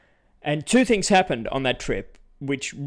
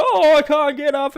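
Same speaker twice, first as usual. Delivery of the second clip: put-on much higher, louder drunken wail; drunk whine; 13 semitones higher, 9 dB louder than usual. Narrator imitating drunk girl stuck on the floor, loud high whine. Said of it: "fell on the floor and is like".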